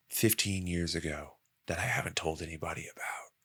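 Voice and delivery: hushed, shaken disbelief, trailing into a whisper; shocked whisper. Retold or imitated. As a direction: whisper it like you've been spooked. Acted stunned quote, whisper.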